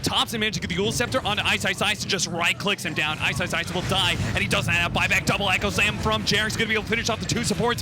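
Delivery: fast, rising esports casting call; hyped play-by-play. Acted. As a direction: build the hype as the fight breaks. Live play-by-play excitement.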